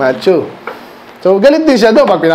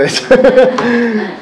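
laughing; 5 semitones higher than usual. Real, not acted. Congregation joke about thieves.

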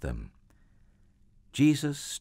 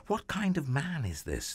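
higher-pitched, mildly anxious question; anxious wondering; 7 semitones higher than usual. Acted. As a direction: ask it with a touch of unease. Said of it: "they asked themselves anxiously".

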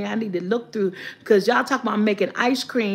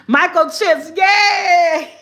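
high, loud squeal of delight; excited cheer; 5 semitones higher, 5 dB louder, much slower than usual. Real, not acted. Revealing the joke answer to viewers, excited cheer. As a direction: cheer the punchline. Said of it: "excited answer reveal".

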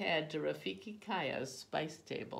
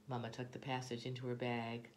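usual speaking voice

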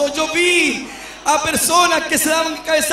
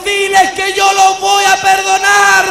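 voice of the Lord; 4 semitones higher, 6 dB louder than usual. Retold or imitated. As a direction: proclaim forgiveness with full force. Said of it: "me dijo el Señor".

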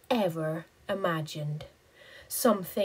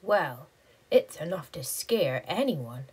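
plain reading voice

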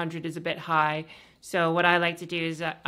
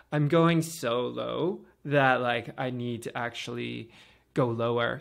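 low, slow masculine voice; man voice; 5 semitones lower than usual. Shown as a demonstration. Voice coach showing viewers a man voice, low and slow. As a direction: push the voice down into a male register. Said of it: "let me just speak in more of a man voice".

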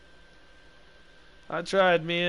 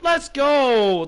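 excited cheer; 6 semitones higher, 7 dB louder than usual. Real, not acted. Cheering after a play.

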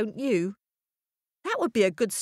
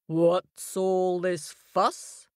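majestic lion; much slower than usual. A narrator's reading. Lion questioning the animals, slow and regal. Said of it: "he asked".

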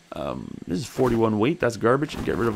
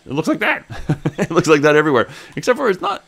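laughing; 6 semitones higher, 5 dB louder than usual. Real, not acted. Laughing about Canadian trees.

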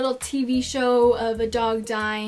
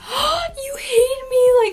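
much higher, slow, wounded whine; hammed-up hurt voice; mock hurt whine; 12 semitones higher, 5 dB louder, slower than usual. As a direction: play the hurt girlfriend. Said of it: "And I'll be like".

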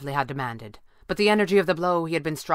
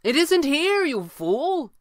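high, loud scornful retort; raised, high-pitched voice; calling down; 11 semitones higher, 4 dB louder, slower than usual. A narrator's reading. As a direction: call it down to a fool below. Red panda retorts from above.

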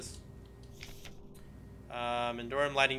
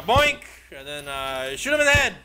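excited commentary; 8 dB louder than usual. Real, not acted. Gamer fighting an enemy, excited shouts. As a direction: shout the hits.

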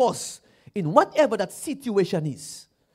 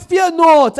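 loud fear not; 9 semitones higher, 12 dB louder than usual. Acted. Quoting God loudly.

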